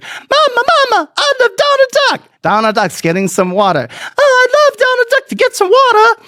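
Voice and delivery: put-on high, squealing child voice, fast; a gushing little kid crying to mama; high excited kid squeal. Kid voice in retold Disneyland story.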